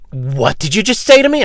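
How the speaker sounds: high, loud indignant demand. Retold or imitated. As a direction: snap back at him, offended. Lady confronts him, retold.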